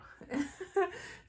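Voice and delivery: high, giggly burst of joy; excited laugh. Real, not acted. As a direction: laugh with pure delight. Laugh after gender reveal.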